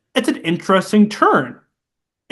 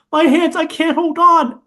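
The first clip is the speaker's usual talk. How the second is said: a much higher, slightly louder panicked whine; mock panicked Bilbo; 12 semitones higher, 4 dB louder than usual. Retelling the movie to a friend, Bilbo in mock panic. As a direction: squeal it like he's slipping off. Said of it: "Bilbo's like".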